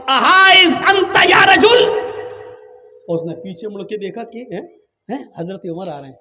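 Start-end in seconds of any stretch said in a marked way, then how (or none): 0.1-2.0 loud, high, slow Arabic call. Retold or imitated. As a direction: call him again, louder. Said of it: "آپ نے پھر زور سے آواز دی".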